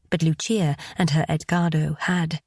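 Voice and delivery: plain reading voice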